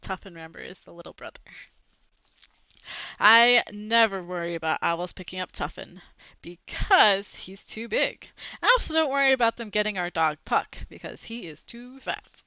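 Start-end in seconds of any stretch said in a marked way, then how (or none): none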